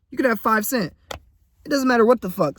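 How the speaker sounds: normal talking voice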